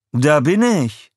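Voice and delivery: high, loud, slow fluted answer; fluting reply. Acted. Herb answers his wife's call, high and fluting. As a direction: trill it back sweetly. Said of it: "flötete Herb".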